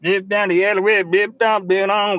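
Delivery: put-on nasal folk-singer [name] accent. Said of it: "how to describe [name]'s accent".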